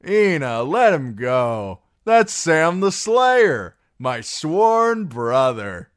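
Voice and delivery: big, loud, slow proclamation; high, ringing, drawn-out shout; boisterous calling out. Acted. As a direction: announce a friend to the whole room. Darion hails Sam across a brothel.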